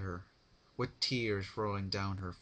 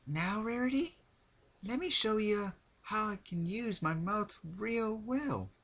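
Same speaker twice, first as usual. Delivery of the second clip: Pinkie Pie voice; 13 semitones higher than usual. Acted. Pinkie to trapped Rarity before cutting, high sweet menace.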